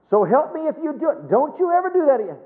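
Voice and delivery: mock scolding parent. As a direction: ham up a parent losing patience. Mimics a parent threatening a child.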